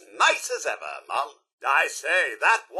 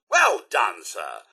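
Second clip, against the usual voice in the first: ordinary delivery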